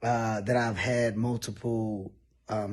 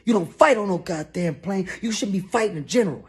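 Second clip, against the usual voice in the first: higher and louder, fast lecture; scolding snap; 7 semitones higher, 5 dB louder, faster than usual. Retold or imitated. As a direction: tell brothers off. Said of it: "Harry snapping".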